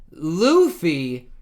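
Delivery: high, loud cry of surprise; startled exclamation. A narrator's reading. The rescued finding Luffy beneath them, loud startled cry. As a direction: blurt the name out in astonishment.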